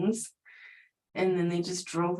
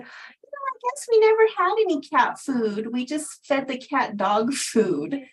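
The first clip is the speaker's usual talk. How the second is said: high airy then loud mocking voice of the neighbor; neighbor lady; 6 semitones higher, 5 dB louder than usual. Neighbor lady confessing they fed the cat dog food, in a high mocking voice. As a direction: mimic the clueless neighbor admitting there's no cat food. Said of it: "she said".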